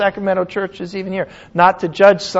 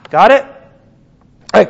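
normal talking voice

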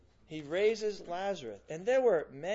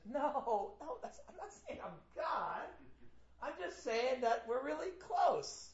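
higher-pitched, hammed-up protest of innocence; mock backpedalling; 6 semitones higher, 4 dB quieter than usual. Preacher imagining Jesus denying the charge to the crowd, mock backpedalling. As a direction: backpedal comically, pitched up and pleading misunderstanding.

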